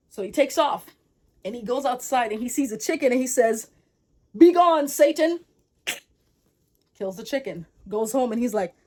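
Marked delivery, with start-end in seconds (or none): 4.4-5.4 loud, high, theatrical command; mock exorcism shout. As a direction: cast out a demon with full hammy force. Acted son banishes supposed demon.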